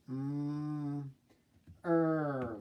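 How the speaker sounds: "Mm" softly held, then "er" drawn out; sounding out. Shown as a demonstration. Teacher spelling mermaid by sound, each sound stretched. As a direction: hum a soft long "Mm", then a long "er". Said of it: "how should it be spelled".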